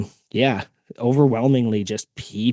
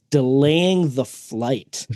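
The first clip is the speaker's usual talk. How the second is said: very slow, deliberate delivery; carefully worded; much slower than usual. Retold or imitated. Telling how friends held the plane in legal terms, drawn out. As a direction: stretch the words out as if choosing them cautiously. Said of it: "the most legal terms I can".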